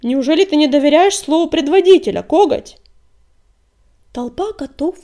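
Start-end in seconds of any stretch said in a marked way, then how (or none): 0.0-2.8 indignant outburst, louder and higher. A narrator's reading. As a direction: round on him in indignation. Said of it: "негодующе обрушился на него".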